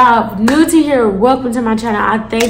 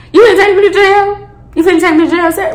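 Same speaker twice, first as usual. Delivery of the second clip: indignant; 7 semitones higher, 4 dB louder, faster than usual. Retold or imitated. Retold protest to officer.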